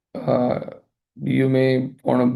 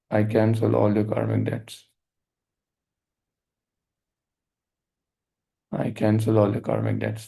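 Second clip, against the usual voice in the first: guided meditation; 4 semitones lower than usual. Acted. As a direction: lead the listener gently into forgiveness. Leads a forgiveness meditation.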